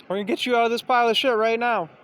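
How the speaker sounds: loud, high, eager offer of help; a helper shouting down into the pit; eager helper call. Retold or imitated. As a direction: call down to him, keen to pull him out. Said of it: "they're like".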